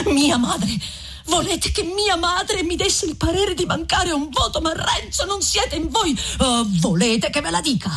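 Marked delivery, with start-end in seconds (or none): 0.1-6.2 put-on a pitched-up, hurt girl objecting; Lucia's voice.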